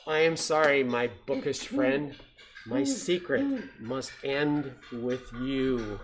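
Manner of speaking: slow, solemn villain's sentence; menacing owl. Acted. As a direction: pronounce the doom slowly and regretfully. Owl dooms the captured mouse.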